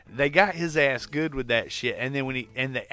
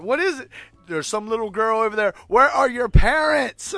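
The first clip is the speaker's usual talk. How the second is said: mock scared yell; 7 semitones higher, 7 dB louder than usual. Lost in the haunted maze, shouting nervously at a little girl. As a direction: call out scared into the dark.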